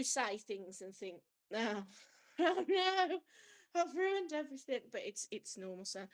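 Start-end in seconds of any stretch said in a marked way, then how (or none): 1.5-4.8 slow, high, laughing mock dismay at saying the wrong thing; playful fake despair, drawn out and high; mock panic. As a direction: ham up the horror of having said "Oh, oh no" with a laugh. Mock panic over a slip.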